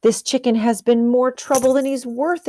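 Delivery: the cook has had enough of the chicken; annoyed cook. Acted. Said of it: "exclaimed the cook".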